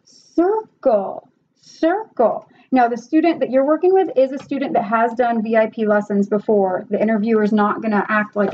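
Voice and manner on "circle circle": put-on high, singsong prompt to a child; teacher demo voice. Shown as a demonstration. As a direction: coax the child to circle. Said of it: "you can circle on your whiteboard".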